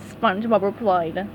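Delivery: ordinary delivery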